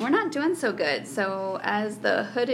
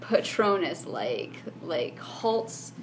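slow dramatic narration; 2 semitones lower, much slower than usual. Acted. Game master scene narration.

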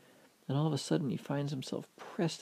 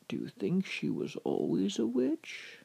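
higher, questioning sage voice; old man character; 7 semitones higher than usual. A narrator's reading. Old man quizzing the boy about the witch, knowing storybook voice. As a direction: pose a riddle as the wise old man.

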